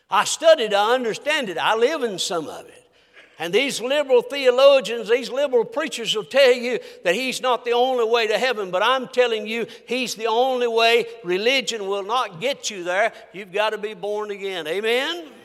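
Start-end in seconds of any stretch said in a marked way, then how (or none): none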